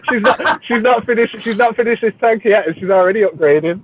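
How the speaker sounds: high, giggly, restarting through laughter; real laughing disbelief. Real, not acted. Teasing that she's upgrading an unfinished tank, laughing. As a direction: struggle to get it out while laughing. Laughing too hard to finish.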